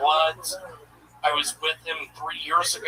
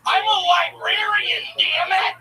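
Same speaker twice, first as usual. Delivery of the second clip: high, loud furious yell; angry dad shout; 14 semitones higher, 4 dB louder than usual. Retold or imitated. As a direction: scream it like an enraged father. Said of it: "That's an impression of my dad".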